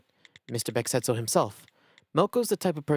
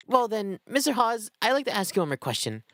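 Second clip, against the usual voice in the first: high detective voice; 9 semitones higher than usual. Acted. Detective character voice.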